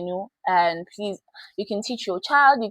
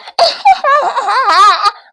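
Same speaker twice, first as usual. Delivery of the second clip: acted mocking laugh; 14 semitones higher, 12 dB louder than usual. Mock laughing at poor girl.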